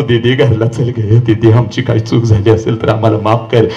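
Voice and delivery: broken father begging at the police station; pleading father. Retold or imitated. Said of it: "आणि म्हणतो".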